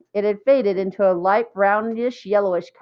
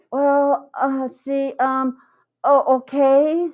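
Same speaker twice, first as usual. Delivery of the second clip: higher, halting stammer; 6 semitones higher, much slower than usual. A narrator's reading. Colleen nervously trying to ask Jeff, high halting stammer. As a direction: trip over the words, flustered. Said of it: "stammered Colleen".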